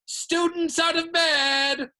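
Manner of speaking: very high, loud, drawn-out alarm cry; bellowing. A narrator's reading. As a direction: holler it down the corridors to raise the alarm. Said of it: "Peeves bellowed".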